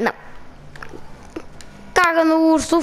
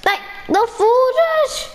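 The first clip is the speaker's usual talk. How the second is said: calling out; 9 semitones higher, slower than usual. Real, not acted. Calling after fleeing creature.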